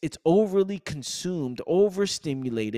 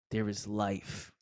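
whispered awe; 5 semitones lower, 10 dB quieter than usual. Real, not acted. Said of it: "that moment right there".

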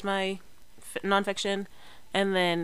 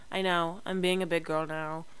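ordinary talk

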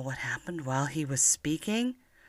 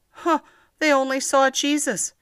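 high, wide-eyed surprise; surprised; 12 semitones higher, 6 dB louder than usual. A narrator's reading. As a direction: gasp and reveal it brightly. Sudden reveal after lifting eyes.

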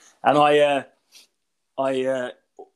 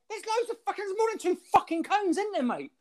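exasperated retort; 6 dB quieter than usual. Retold or imitated. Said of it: "getting really wound up".

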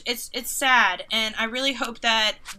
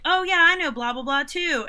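sarcastic mock brag, higher and louder; a hammed-up sarcastic comeback; mock one-upping; 6 semitones higher, 6 dB louder than usual. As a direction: playfully throw their boast right back. Mocking the name-droppers back.

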